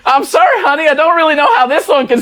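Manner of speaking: sweet husband. Acted. Sweet detective returns.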